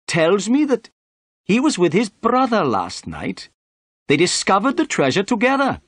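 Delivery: high-pitched, pompous inspector; self-important police inspector laying down his view. A narrator's reading. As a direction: hold forth importantly in a high character voice.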